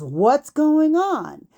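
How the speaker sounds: retold demand, drawn out; alarmed mother. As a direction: voice a mother stretching out her question. Mother confronts the commotion outside.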